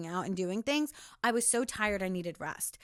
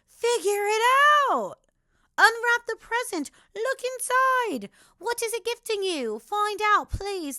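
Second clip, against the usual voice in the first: high, loud, insistent push; emphatic urging; 9 semitones higher, 7 dB louder than usual. Real, not acted. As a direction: urge them to open it up. Urging viewers to unwrap present.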